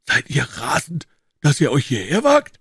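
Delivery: high, loud, startled outcry; alarmed cry. A narrator's reading. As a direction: cry out in shock at the danger. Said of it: "rief er".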